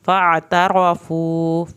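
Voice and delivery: فاعترفوا slowly recited, ع from the throat; Quran recitation. Shown as a demonstration. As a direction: stretch فاعترفوا with tajweed. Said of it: "عین کو حلق سے پڑھیں".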